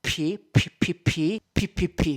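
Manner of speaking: bursts of hard, punchy P plosives; popped P sounds. Shown as a demonstration. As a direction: puff sharp P's straight into the mic. Testing plosive pops on the microphone.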